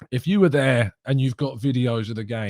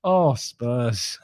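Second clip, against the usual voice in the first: ordinary delivery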